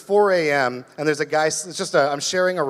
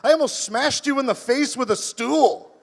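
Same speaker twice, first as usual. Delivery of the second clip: roommate voice; 7 semitones higher than usual. Retold or imitated. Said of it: "And he's like".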